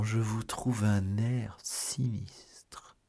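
slow, whispery voice; grave hush. A narrator's reading. Athos finds his friend gloomy, speaking in a slow hush. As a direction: draw it out slowly, sinking into breath.

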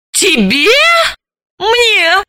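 high, slow scornful shout; презрительно; contemptuous cry. A narrator's reading. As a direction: spit out contempt at her. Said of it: "презрительно выкрикнула Заматаева".

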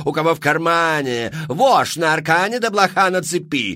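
high, loud, theatrical madame pitching to the crowd; showy saleswoman. Acted. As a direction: sell it like a grand Parisian hostess. Мадам Фру-Фру вещает толпе. (высокий громкий голос мадам-зазывалы)

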